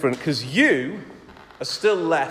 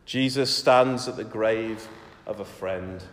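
ordinary delivery

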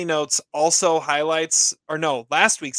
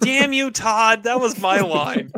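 mock outrage; 6 semitones higher, 5 dB louder, slower than usual. Co-host mock outrage over stolen line.

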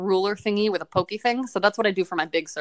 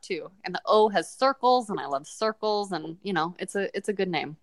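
normal talking voice

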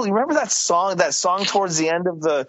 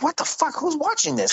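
much higher, disbelieving shriek; incredulous squeal; 13 semitones higher than usual. Retold or imitated. Retelling his reaction to the YouTube views, high incredulous squeal. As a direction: squeak out your disbelief at the view count. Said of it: "I'm like".

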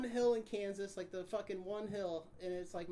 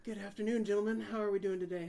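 louder, formal cop greeting; imitated traffic cop; 6 dB louder than usual. Cop to two brothers in a pulled-over car, imitated official greeting. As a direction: greet them like an officer at the window. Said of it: "He comes up to my brother's window".

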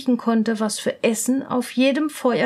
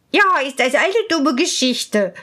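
a touch of an irritated princess, louder; annoyed princess; 5 dB louder than usual. Acted. Princess sighs over the deal, mildly annoyed. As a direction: grumble at the nuisance. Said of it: "sagte die Prinzessin".

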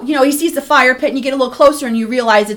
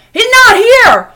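higher, louder, drawn-out shout; retold yell; 7 semitones higher, 5 dB louder than usual. Man yelling down to kids in the woods, retold yell. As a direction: yell it down to someone far off. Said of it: "so Arnie yells down to him".

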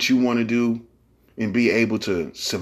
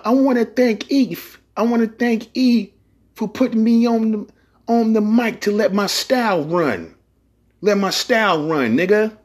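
imitating [name]; 12 semitones higher than usual. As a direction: quote [name] in a much higher voice. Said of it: "just like [name] said".

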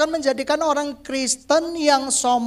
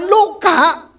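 usual speaking voice

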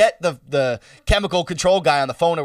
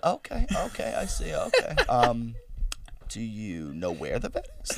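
very soft, placid imitation; low-key, unbothered mom, quietly imitated; very calm; 7 semitones lower, 10 dB quieter than usual. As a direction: murmur it with mock serenity. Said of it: "It was so fucking calm".

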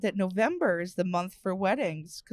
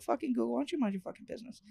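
mock scolding; 2 semitones higher, 7 dB quieter, much faster than usual. Scolding Google, fast aside.

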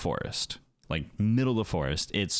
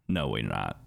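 deep flat refusal; 11 semitones lower than usual. Retold or imitated. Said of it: "I was like".

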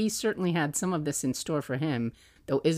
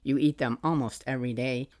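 ordinary talk

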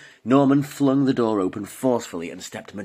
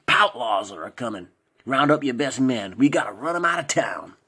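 slow, louder put-on American cowboy accent; affected cowboy drawl; 4 semitones higher, 4 dB louder than usual. A narrator's reading. Norman warns the pastor of Poutlaws, loud fake American drawl. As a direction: lay the fake American drawl on thick, grave and forceful. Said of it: "forcefully in his affected cowboy drawl".

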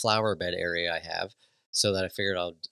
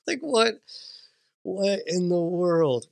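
stunned disbelief; 8 semitones higher, slower than usual. Retold or imitated. Dad retelling shock at son's words, high disbelief. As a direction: replay the baffled disbelief. Repeating his stunned reaction.

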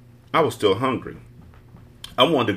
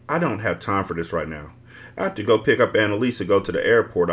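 plain reading voice

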